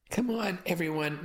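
lightly called-out invitation; calling out. A narrator's reading. Mother inviting guests in to eat, calling out. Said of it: "my mother called".

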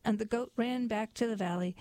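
ordinary delivery, nothing marked

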